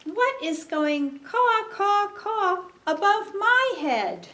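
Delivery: high, loud caw-caw-caw; squawking crow. Acted. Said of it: "A crow is squawking above my head".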